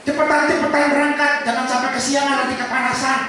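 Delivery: loud, high-pitched hurry-up call; acted urging. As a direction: imitate a wife rushing everyone out the door. Istri mendesak keluarga cepat berangkat. (mendesak dengan suara keras melengking)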